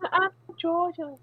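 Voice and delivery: high, slow Georgia drawl; a Southern belle introducing herself in a Georgia accent. Retold or imitated. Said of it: "the cutest accent ever".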